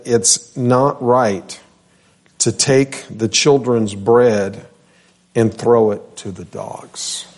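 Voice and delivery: slow, weighty, stress on "not"; slow solemn reading. Retold or imitated. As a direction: lean on "not" and let each phrase land slowly. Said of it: "And he answered and said".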